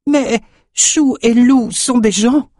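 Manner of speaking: very high, slow protest; pleading old woman. A narrator's reading. Woman defending her goats to the boat owner, pleading. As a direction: plead for them in a high, drawn-out voice.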